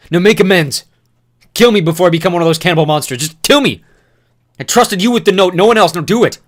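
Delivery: very loud, high desperate scream; screaming demand. Acted. Newt begging Thomas to kill him, screaming. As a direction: demand death at full voice.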